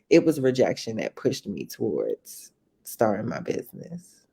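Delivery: ordinary delivery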